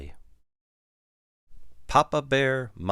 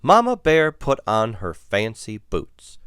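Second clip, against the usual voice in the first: usual speaking voice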